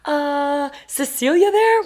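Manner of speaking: scared high voice. Retold or imitated. Improvising a name at the call box, scared and squeaky. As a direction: squeak out a hesitant, nervous question. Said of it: "your voice goes up, like, nine octaves when you get really scared".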